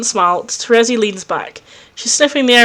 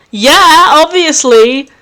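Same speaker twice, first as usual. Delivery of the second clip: loud, higher, slow drawn-out obviously; cocky yes; 6 semitones higher, 7 dB louder, much slower than usual. Acted. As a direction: toss it off like it's obvious. Vriska accepts the offer.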